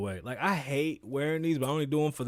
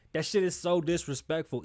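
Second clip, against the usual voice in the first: ordinary talk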